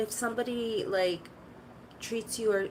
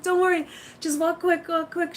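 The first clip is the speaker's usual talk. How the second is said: urgent hurry; 6 semitones higher, 5 dB louder than usual. Retold or imitated. Hurrying daughter past the store.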